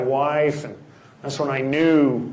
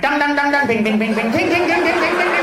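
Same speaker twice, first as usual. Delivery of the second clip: imitating casino noises; 10 semitones higher, faster than usual. Neighbors arguing like a casino, in mock slot-machine chimes. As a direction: ring it out like a slot machine paying off. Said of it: "their apartment sounds like a casino".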